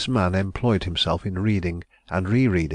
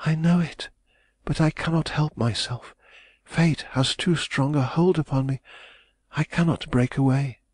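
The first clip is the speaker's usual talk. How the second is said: put-on distressed young woman; 8 semitones higher than usual. A narrator's reading. Heroine resists his pleading.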